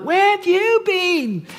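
put-on high, slow kid-brother voice; little brother. Little brother greets the lost boy in a high child's voice. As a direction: pitch it up like a small boy. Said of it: "even my little brother was like".

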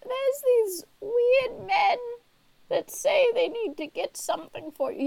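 put-on high old-lady voice, easing down; old woman Nancy.

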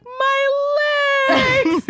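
very high, drawn-out mock wail; hammed-up cry of a kid giving out; struggling little brother. Little brother near the escalator top, high mock wail. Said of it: "he's, like, really struggling".

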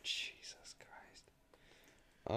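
whispered. Real, not acted. Disgusted reaction.

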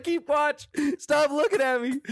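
high, embarrassed retold begging; mock desperate plea.

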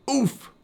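high sharp grunt as he lands flat; knocked-breath oof on landing; impact grunt. Acted. Landing flat on the water, high grunt. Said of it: "he grunted with the force".

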